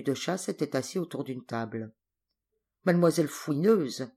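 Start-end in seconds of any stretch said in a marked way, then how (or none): none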